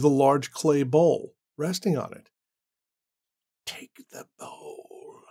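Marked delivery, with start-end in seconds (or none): 3.6-5.2 slow, unvoiced whisper; eerie whisper. A voice inside Randall's head, slow eerie whisper. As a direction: breathe it like a voice in his head. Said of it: "a voice inside Randall's head whispered".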